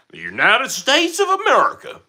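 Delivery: over-the-top patriotic American twang; exaggerated American accent. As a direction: belt out the country's name like a cartoon American. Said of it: "the most American accent".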